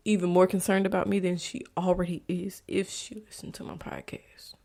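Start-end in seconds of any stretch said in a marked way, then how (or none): 2.3-4.5 whispered admission. Real, not acted. Admitting she hides it from therapist.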